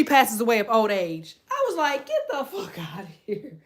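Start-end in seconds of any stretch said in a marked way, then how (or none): 2.1-3.5 laughing disbelief. Retold or imitated. Disbelief at the old-age death claim.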